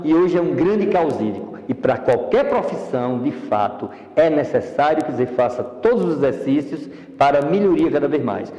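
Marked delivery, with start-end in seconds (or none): none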